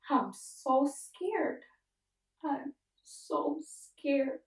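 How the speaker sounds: high, very slow fearful plea; crying and scared. Retold or imitated. Patient calls friends late at night, crying and scared. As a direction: draw it out slowly, pitched high and tearful. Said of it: "I was just crying and saying".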